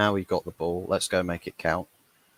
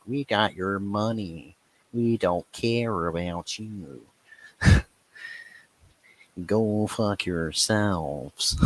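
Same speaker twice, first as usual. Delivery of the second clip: slow, smug tannoy voice; lightly voiced arrogant owner; mock owner announcement; much slower than usual. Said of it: "It's just like".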